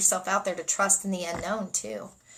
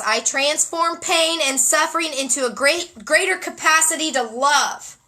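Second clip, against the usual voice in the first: excited reading; 6 semitones higher, 4 dB louder than usual. Real, not acted.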